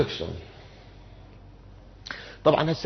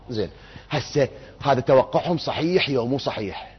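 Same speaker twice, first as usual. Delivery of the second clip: usual speaking voice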